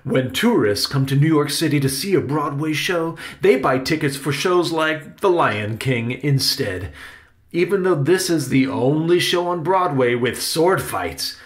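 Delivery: emphatic uncle holding forth; exasperated Uncle Riley. Acted. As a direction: explain the problem with stage-actor force. Uncle explains poor sales.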